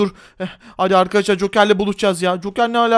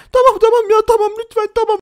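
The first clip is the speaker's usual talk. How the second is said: high, loud, panicked pleading; terrified teller handing over money; begging hostage; 10 semitones higher, 3 dB louder than usual. Acted. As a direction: beg for your life in a squeaky voice.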